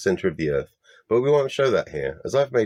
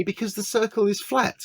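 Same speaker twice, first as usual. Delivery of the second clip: "flat" rises much higher in a mocking tone; mocking quote; 13 semitones higher than usual. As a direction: quote it back in a sing-song mocking way. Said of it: "Quotes me".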